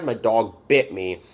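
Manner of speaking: normal talking voice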